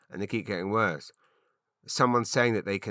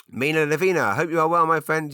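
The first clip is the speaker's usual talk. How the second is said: high, loud, sung-out greeting; 8 semitones higher, 8 dB louder than usual. Acted. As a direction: hail a friend at full volume, pitched way up. Greets letter writer in raised voice.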